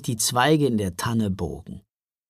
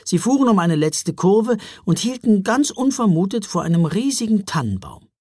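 ordinary delivery

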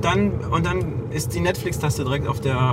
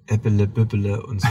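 mock Palatinate; 5 semitones lower than usual. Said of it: "Das Pfälzisch".

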